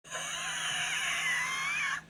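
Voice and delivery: high, strained exaggerated sigh. Real, not acted. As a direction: let out a long dramatic sigh. Tired after reading chat.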